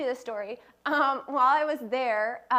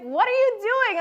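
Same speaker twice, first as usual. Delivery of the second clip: mom voice; 9 semitones higher, 6 dB louder than usual. Retold or imitated. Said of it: "she'd be like".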